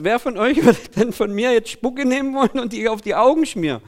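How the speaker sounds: ordinary delivery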